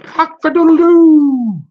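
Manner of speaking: loud, high, slow crowing; rooster crow. Acted. Rooster crowing at Turkey, loud and high. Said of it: "crowed the rooster".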